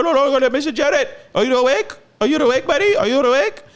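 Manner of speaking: high, loud mock nurse voice calling him awake; imitating the nurse. Nurse waking a groggy patient, high loud mock call. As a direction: play the rowdy nurse rousing a patient.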